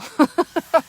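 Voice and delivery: bright burst of amused laughter, high and loud; real laugh. Real, not acted. Host joking about her dog's tube of denial, real laugh. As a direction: laugh out loud at your own joke.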